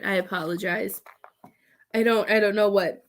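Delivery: ordinary talk, nothing marked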